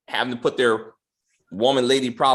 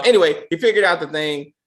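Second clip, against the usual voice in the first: ordinary talk, nothing marked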